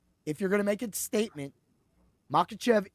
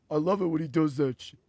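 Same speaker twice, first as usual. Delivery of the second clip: imitated movie line, pitched down; mock movie-character aside; low character voice; 5 semitones lower than usual. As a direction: drop low for the quoted reply. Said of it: "He looks over and he goes".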